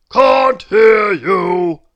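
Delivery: slow, very loud roar; milkman's drawn-out shout. A narrator's reading. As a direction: roar it out word by word. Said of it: "roared the milkman".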